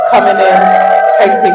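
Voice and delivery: loud happy shout-out; calling out. Real, not acted. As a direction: hail her as she walks in. Friend walks into the studio.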